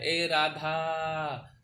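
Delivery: very slow, long drawn-out call, vowel stretched; calling out a name. Shown as a demonstration. Teacher demonstrating calling someone by name, long drawn-out call. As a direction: call Radha across a distance, holding the last vowel. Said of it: "हम लोग ज़ोर से बोलते हैं तो उसमें ज़्यादा समय लगता है".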